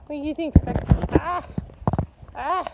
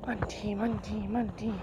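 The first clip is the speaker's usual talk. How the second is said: softer, lower sing-song name; soft call; 6 semitones lower, 8 dB quieter than usual. Real, not acted. Owner softly cooing her dog's name, hushed and lower. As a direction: coo the name gently. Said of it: "soft calling".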